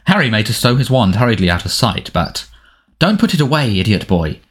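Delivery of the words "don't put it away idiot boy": plain reading voice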